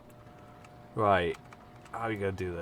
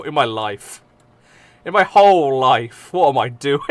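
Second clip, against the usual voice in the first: mock despair; 7 dB louder than usual. Rising mock existential despair.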